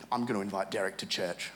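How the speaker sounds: put-on soft, low childhood thought; hushed eager kid plan; quiet inner thought. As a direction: think it to yourself quietly.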